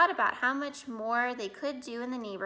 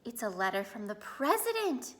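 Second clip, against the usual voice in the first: gasped. A narrator's reading. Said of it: "he gasped".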